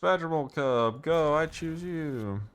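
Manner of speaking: hyped YouTuber voice. Pretend. Hyped voice continues after remark.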